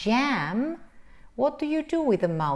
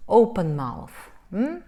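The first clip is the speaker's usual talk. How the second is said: normal talking voice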